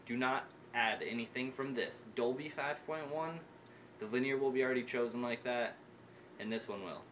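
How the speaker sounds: ordinary talk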